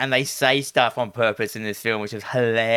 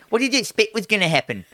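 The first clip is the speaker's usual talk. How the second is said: high, quick go at a New Zealand accent; attempted New Zealand accent; 9 semitones higher, faster than usual. Shown as a demonstration. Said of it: "I'll actually have a proper New Zealand accent".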